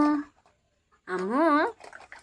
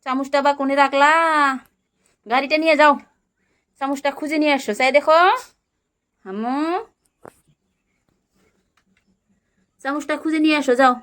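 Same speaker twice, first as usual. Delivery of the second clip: put-on high, bright play voice for a small child; playful toddler-talk; 7 semitones higher, 7 dB louder than usual. Mother playing house with her toddler, high coaxing voice. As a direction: coax the little one along in pretend play.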